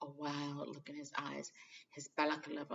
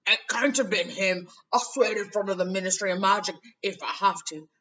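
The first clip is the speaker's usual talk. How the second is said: loud, higher, agitated rant; 4 semitones higher, 13 dB louder than usual. A narrator's reading. Hagrid rants to Dumbledore in loud agitation. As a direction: rant it out, waving your arms. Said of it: "Hagrid went ranting on".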